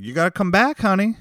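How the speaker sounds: a hammed-up sweet restaurant lady; imitating the waitress. Podcaster imitating the nice restaurant owner, high sweet voice. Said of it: "She's like".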